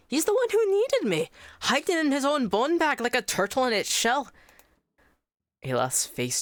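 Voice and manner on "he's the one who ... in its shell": much higher, louder sneering spirit voice; a taunting spirit voice pitched high; mocking spirit. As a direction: jeer at him from inside his head.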